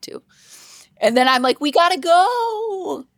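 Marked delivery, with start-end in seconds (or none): 1.6-3.1 high, drawn-out mock wail; retold whiny plea. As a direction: stretch it out in a rising, pleading cry. Retelling her plea to leave.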